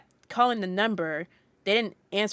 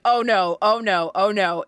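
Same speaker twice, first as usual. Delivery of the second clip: retold panic; 7 dB louder, slower than usual. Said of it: "I started, like, freaking out".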